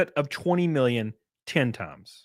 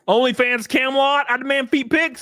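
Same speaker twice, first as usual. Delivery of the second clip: mock demand; 9 semitones higher, 3 dB louder than usual. Reading chat message aloud.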